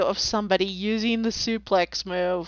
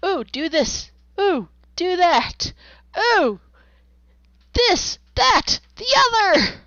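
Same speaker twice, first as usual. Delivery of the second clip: higher and higher, giddy ooh; building excitement, each ooh higher; excited inner voice; 7 semitones higher than usual. Retold or imitated. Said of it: "so my brain goes".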